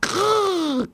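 high, squeaky little growl; little dragon growl. A narrator's reading. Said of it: "knurrte er".

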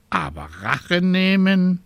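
high, fussy scolding raven voice. A narrator's reading. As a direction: play a worried, scolding raven. Raven doubts.

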